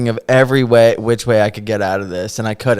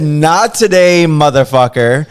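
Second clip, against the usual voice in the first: slow, loud, cocky; loud defiant shout; 4 semitones higher, 6 dB louder than usual. Retold or imitated. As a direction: draw it out loud and proud. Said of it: "I said".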